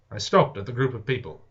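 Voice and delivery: ordinary delivery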